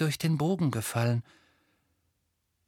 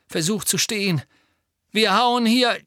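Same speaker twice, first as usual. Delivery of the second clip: urgent call; 7 semitones higher, 5 dB louder than usual. Acted. Harry urging Neville in battle.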